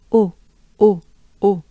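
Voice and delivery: O short and closed, in separate bursts; closed, dry O. Shown as a demonstration. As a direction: isolate a tight, dry French O. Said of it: "Pretty closed up".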